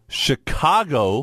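slow, stretched announcer build-up; dramatic announcer reveal. Acted. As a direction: draw it out for suspense.

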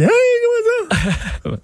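very high, slow mock squeal; imitating a squeaky reaction. Cohost imitating the host's surprised squeaky reply. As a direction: stretch it out in a falsetto squeak. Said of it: "puis t'as dit".

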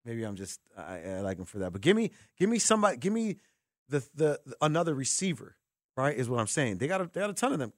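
normal talking voice

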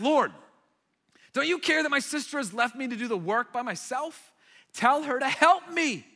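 loud, high indignant plea; frustrated Martha. Retold or imitated. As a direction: complain to the Lord, demanding help. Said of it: "she's gets, getting really frustrated".

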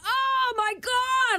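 high, long-drawn mock wail of pain; retold scream. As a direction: stretch it out as an imitated scream. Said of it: "I just start screaming".

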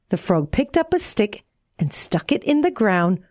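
plain reading voice

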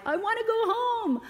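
put-on high, pleading cry of a distressed boy; crying child. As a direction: cry it out like an overwhelmed little boy. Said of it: "and he cried".

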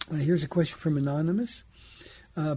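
ordinary talk

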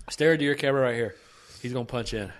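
normal talking voice

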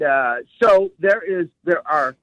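ordinary talk

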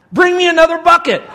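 high, very loud eager demand; excited holler. Retold or imitated.

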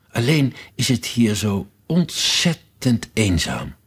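plain reading voice

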